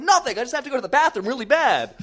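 high, loud, hurried excuse; frantic, rushed denial; panicked cover-up. Retold or imitated. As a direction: blurt it out in high-pitched panic.

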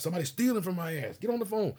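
high, panicky mock wake-up cry; mock alarmed owner; panicked club owner. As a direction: voice a frantic boss jolted awake. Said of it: "You wake up in the middle of the night".

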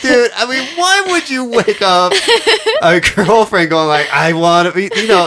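put-on high, laughing disbelief at the boyfriend; incredulous dude voice. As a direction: play it as an outraged buddy. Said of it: "I would have been like".

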